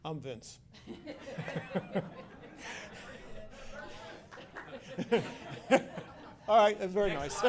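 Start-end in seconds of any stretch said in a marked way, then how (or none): 0.9-7.5 laughing through the words; real amused laugh while speaking. Real, not acted. New project director laughing at his awkward intro.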